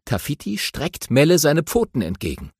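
ordinary delivery, nothing marked

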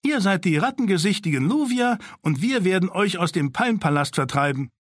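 put-on high, commanding girl's voice; bossy girl. Sina bossily tells Daron's side they are the enemy. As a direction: lay down the rules like a bossy leader.

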